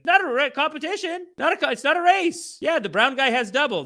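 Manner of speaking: not a race, said with a smirk; mock-modest gloat. Real, not acted. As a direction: gloat while pretending to be humble.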